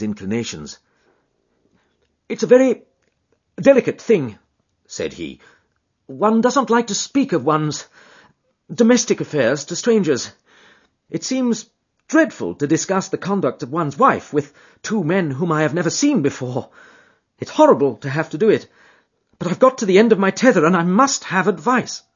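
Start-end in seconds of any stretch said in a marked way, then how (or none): 2.3-4.4 much louder, high, halting burst; jerky outburst. Acted. Husband forcing out his trouble, jerky outbursts. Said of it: "little, sharp, jerky outbursts". 6.1-22.0 louder, raised, pushed quickly at the end; strain, then rush it out; jerky outbursts. Acted. Husband confessing to strangers, agitated and rushing. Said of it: "little, sharp, jerky outbursts".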